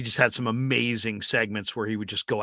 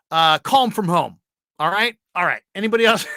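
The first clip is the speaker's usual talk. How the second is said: imitating the PD; 5 semitones higher, 6 dB louder, faster than usual. PD dismissing Ralph in meeting.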